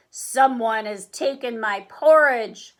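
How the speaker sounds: loud Papa Bear bellow; big deep bellow. A narrator's reading. Papa Bear sees the table, big deep bellow. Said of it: "bellowed in his big, deep voice".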